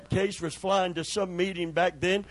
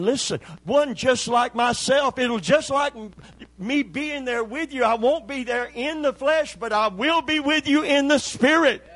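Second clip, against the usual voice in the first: higher, louder preaching build; full-force preaching; 7 semitones higher, 4 dB louder than usual. Acted. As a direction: preach it out with rising fervor. Preaching climax.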